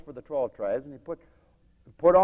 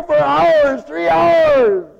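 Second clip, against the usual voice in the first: high, very loud preacher's cry; full-force shout; 18 dB louder, much slower than usual. Acted. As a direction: keep screaming it out like the frenzied crowd. Preacher carries on the frenzied cry.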